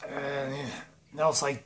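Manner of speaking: usual speaking voice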